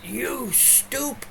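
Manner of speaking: high mock scold, mom voice; shrill scold at the dog on the couch. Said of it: "She would go".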